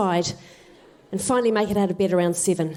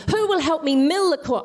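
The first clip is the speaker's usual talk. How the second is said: higher-pitched exasperated appeal; exasperated hen; 6 semitones higher than usual. Acted. Little red hen asking for help again, exasperated. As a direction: ask it as a fed-up hen, pitched up.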